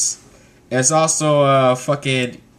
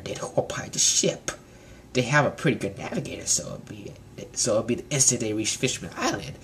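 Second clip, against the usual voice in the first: hushed villain talking to himself; scheming Koribu; 3 semitones lower, 4 dB quieter than usual. Retold or imitated. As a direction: plot in a creepy half-whisper. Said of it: "And Koribu's like".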